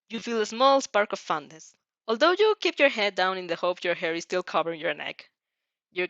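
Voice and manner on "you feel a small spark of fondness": plain reading voice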